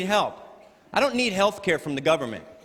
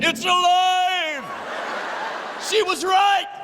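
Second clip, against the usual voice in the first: high, strained mock-horror cry; drawn-out melodramatic high wail; horrified exclamation; 13 semitones higher, much slower than usual. Comedian acting out the threat come true, high strained cry. Acts out the snot coming alive.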